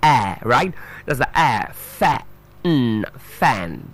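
"fan" broken into its sounds, the vowel held; sounding out. Shown as a demonstration. Teacher breaking down the word fan, sound by sound. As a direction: draw out the a, then hum the n and join "fan". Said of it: "the sound there is".

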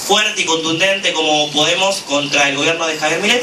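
ordinary talk, nothing marked

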